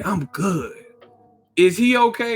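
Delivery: high, smug mock fighter; mock petty boxer. Podcaster mimicking Charlo at an interview, high and mocking. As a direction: taunt the beaten man with a singsong.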